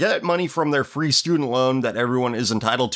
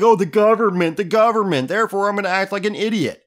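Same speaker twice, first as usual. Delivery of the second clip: mock complaining; 6 semitones higher than usual. Host mocking those who blame the government, mock complaint. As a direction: imitate an idiot blaming others.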